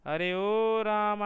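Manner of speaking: slow, loud calling-out with Rama stretched; calling from afar. Shown as a demonstration. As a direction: call Rama to someone standing far away, holding the last vowel. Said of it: "तो वह इस प्रकार बोलेगा".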